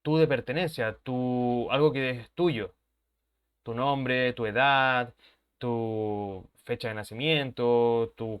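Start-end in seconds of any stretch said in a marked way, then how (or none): none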